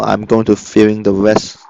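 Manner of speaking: ordinary delivery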